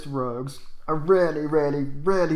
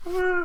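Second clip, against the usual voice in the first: sudden high-pitched villain gasp; creepy high gasp. Retold or imitated. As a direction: let out an eerie high "ah". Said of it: "That was a creepy voice".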